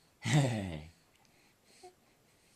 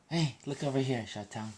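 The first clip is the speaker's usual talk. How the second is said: ordinary delivery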